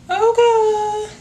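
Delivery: high, loud burst of okay; loud squeaky okay; sudden excited okay. Real, not acted. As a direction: blurt it out, suddenly hyped. Bursts out after serious point.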